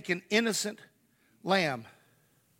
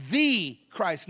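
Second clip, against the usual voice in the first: "the" stressed as "thee", rising; emphatic "thee Christ". Shown as a demonstration. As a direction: lean on "the" before Christ. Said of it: "definite article".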